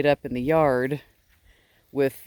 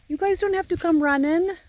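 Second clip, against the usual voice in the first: high sing-song coo to the animals; pet talk to sheep; 14 semitones higher, 4 dB louder than usual. Real, not acted. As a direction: croon it up high like talking to babies.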